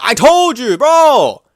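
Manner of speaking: high, loud I-told-you-so yell; excited shout. Real, not acted. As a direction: shout it out, totally vindicated. Vindicated outburst at chat.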